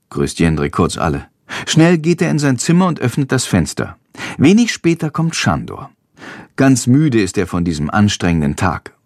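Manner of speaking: usual speaking voice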